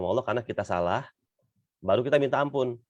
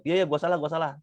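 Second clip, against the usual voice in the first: dismissive apology; 7 semitones higher than usual. Retold or imitated. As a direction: brush it off, quick and annoyed. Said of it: "kalau nadanya begitu". (minta maaf dengan nada ketus, asal-asalan)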